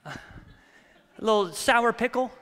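normal talking voice

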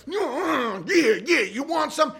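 high mock taunt from a burglar; taunting intruder. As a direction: goad him in a put-on voice. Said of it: "And he's like".

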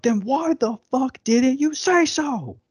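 high, loud acted conductor's yell; furious mock outburst; exploding in anger. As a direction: blow up at the player, pitch way up. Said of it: "Fletcher just lights up".

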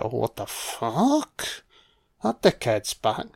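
annoyed Saruman. Retold or imitated. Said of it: "Oh, Saruman's like".

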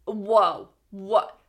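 loud, disbelieving cry of protest; shocked outburst. Real, not acted. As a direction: shout it out, stunned. Outraged at the five rule.

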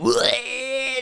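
high, drawn-out mock heave; imitated retching. Dude next to me, imitated retching. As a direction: mimic a puking groan.

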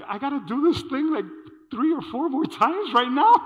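mock dismayed outburst. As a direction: throw your hands up, laughing in disbelief.

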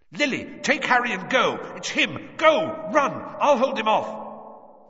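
high, loud frantic orders; panicked shouting. A narrator's reading. Man telling his wife to flee with Harry, panicked shouting. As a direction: shout in panic, urging her to run. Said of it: "shouting, panicking".

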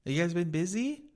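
high-pitched mock small talk; chatty stranger. Random coworker making small talk, high mocking voice. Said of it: "some random person that you don't really ever talk to".

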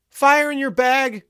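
very high, loud, slow cry; passed-on shout. A narrator's reading. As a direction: yell it far down the queue in a high voice. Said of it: "the call spread down the line".

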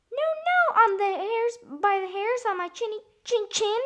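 very high squeaky pig voice; high, loud storybook pig; little pig. A narrator's reading. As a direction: squeal the refusal as the piglet. Pig answers wolf in story.

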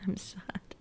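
soft sad whisper to herself. Real, not acted. To viewers, a real whispered sadness. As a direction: whisper it after a small laugh. Sad about leaving her students.

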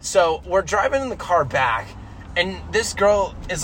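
ordinary talk, nothing marked

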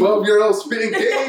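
high laughing tease. Real, not acted. Host teasing guest about flirting at twelve, laughing. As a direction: tease him through a laugh.

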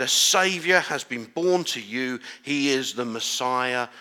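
slow, solemn scripture reading. Acted. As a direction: proclaim the verses slowly. Reads scripture aloud.

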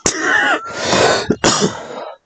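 loud hacking coughs. Real, not acted. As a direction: cough hard, choking.

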